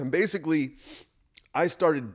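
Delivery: choking. Real, not acted. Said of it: "I was just choking".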